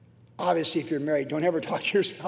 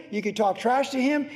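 ordinary talk

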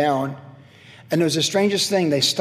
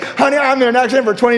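higher, louder, quick brag; mock boast; 8 semitones higher, 6 dB louder, faster than usual. Husband trying his old boast on his wife, mock brag. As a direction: rattle off the boast to honey. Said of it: "It's like".

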